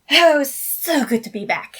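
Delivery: high, loud, flamboyant cry of delight; joyful exclamation. Acted. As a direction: burst out thrilled to be back. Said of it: "Alexander exclaimed".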